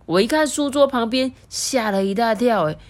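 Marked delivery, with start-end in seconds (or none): none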